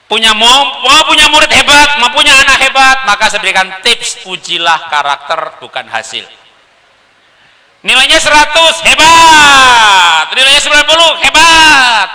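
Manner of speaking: high, loud, driving sermon voice; rally the hall like a preacher; impassioned preaching. Acted. Speaker urging parents on national unity, preaching at full force. Emphatic praise example.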